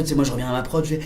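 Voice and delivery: ordinary talk, nothing marked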